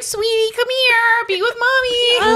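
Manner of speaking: mock sweet mom. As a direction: coo to the child like a sing-song mother. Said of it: "and you're like".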